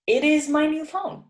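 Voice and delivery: 1 lively high dialogue demo. Shown as a demonstration. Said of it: "So you could say".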